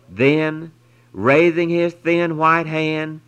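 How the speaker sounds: plain reading voice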